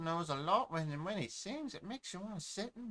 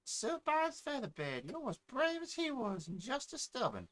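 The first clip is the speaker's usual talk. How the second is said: put-on much higher, bright village girl; sister's put-on voice; 8 semitones higher than usual. Little sister praising her mother, high voice. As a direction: pitch way up to play the little sister. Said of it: "two different accents".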